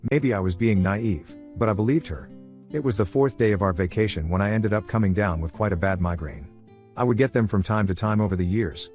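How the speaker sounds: plain reading voice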